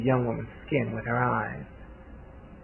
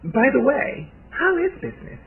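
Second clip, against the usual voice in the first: much higher, airy society-lady voice; feigned casual question; 12 semitones higher than usual. A narrator's reading. Hostess baiting a guest about business, high feigned-casual voice. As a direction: lift it high, as if innocently asking. Hostess slyly setting a trap.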